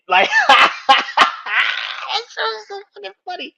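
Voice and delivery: loud, very high, slowed by laughter; real laughing fit through the words; laughing helplessly. Real, not acted. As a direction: struggle to speak while cracking up. Laughing fit while commenting.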